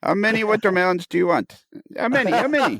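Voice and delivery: high playful Italian vendor voice; Italian accent. Retold or imitated. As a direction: call it out like an Italian market seller. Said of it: "In your best Italian accent".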